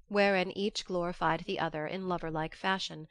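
ordinary delivery

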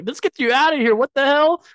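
high, urgent imitated outburst; mock alarm. Chloe hurrying Clark out of the office, mock alarm.

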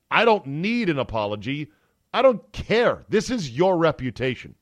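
high, loud, fed-up burst; exasperated outburst. Real, not acted. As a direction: throw it away with real irritation. Annoyed at Mickelson apology.

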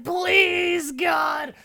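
very high, hammed-up shriek; impatient begging. Condemned man losing patience with the priest, hammed-up begging shriek. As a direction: beg in a shrill mock scream. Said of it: "the guy gets impatient".

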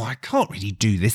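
ordinary talk, nothing marked

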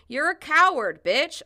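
loud, high insult; yelling. Retold or imitated. Woman protester to gun-holder, yelling. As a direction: hurl it as a shouted insult. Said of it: "a woman yells".

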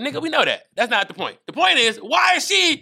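high, insistent comic retort; acted exasperation. People answering Will's confession, acted exasperation.

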